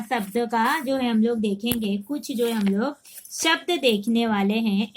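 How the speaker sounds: ordinary delivery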